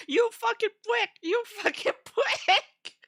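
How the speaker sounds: put-on echoing the redub's anime girl with a laugh; imitating the anime girl dub. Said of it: "I love the way she said".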